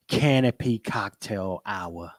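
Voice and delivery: ordinary delivery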